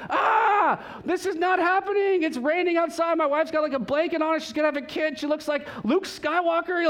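high, loud, racing panic; a husband losing it at locked doors; rising frantic disbelief. Retold or imitated. Locked out, wife in labor.

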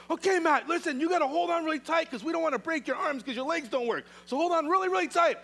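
high, loud warning down to a friend; loud urgent call. Acted. Friends on the roof call to Matt before lowering him, high loud warning. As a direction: call out firmly so he holds on.

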